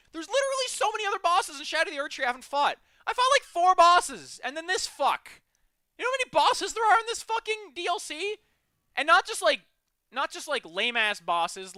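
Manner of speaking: high, loud exasperated ranting; frustrated rant. Real, not acted. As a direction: rant at full volume. Ranting about the DLC.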